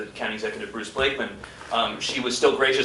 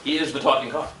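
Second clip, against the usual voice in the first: ordinary talk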